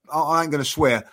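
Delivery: ordinary delivery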